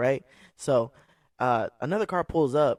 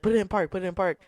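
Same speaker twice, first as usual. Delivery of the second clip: mock cop command; 7 semitones higher, faster than usual. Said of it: "aggressively telling her now".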